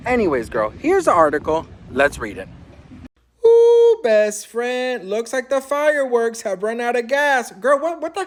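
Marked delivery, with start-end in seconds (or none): 3.4-4.0 high, loud delighted ooh; excited squeal. Real, not acted. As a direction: squeal with glee. Gleeful intro before reading.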